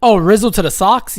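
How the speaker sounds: loud startled cry; loud outburst. Real, not acted. Streamer reading a trade rumor, loud alarmed outcry. Reacting to Red Sox rumor.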